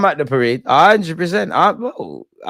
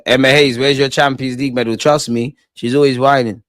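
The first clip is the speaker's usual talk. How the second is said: ordinary talk, nothing marked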